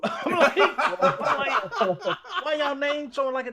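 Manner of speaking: high laughing disbelief. Real, not acted. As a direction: laugh through the words. Laughing while telling story.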